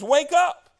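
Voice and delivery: shouted wake-up call. Retold or imitated. David shouting across to the sleeping soldiers. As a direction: yell it across the valley. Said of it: "someone's yelling".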